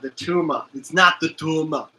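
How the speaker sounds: put-on lightly done Austrian accent, "Tuma" with a rolled r; mild Austrian action hero denying a tumor; Arnold Schwarzenegger. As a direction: Austrian movie star voice, "It's not the Tuma" insisted. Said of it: "another one I forgot".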